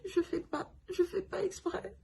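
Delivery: high, soft, broken sobbing plea; protesting to the nurse through tears. Retold or imitated. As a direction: sob it out, voice cracking up high. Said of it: "J'étais même maintenant en sanglots".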